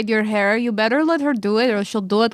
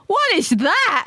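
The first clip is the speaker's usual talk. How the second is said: startled; 7 semitones higher, slower than usual. Acted. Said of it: "where I go".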